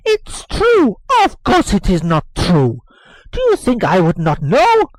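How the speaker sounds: loud, high indignant denial; indignant nurse. Acted. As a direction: snap back that it isn't true. Nurse denying angrily.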